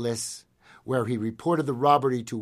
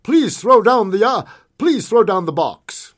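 firm shouted order, high and loud; firm, distinctive call; 8 semitones higher, 11 dB louder than usual. A narrator's reading. Masked gunman halting a stagecoach, firm loud call. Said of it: "In a firm, distinctive voice, he called out".

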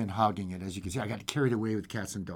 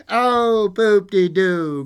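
loud, drawn-out pooh-poohing; carefree creature scoffing; dismissive cry; 7 semitones higher, 11 dB louder, much slower than usual. Acted. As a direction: brush it off with a slow, loud cry. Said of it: "cried the fly-by-night".

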